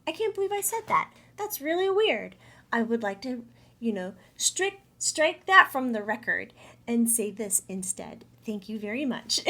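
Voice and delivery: high and bright, like catching your own slip; high, loud self-correction. Retold or imitated. You talking to yourself after a slip, high and loud.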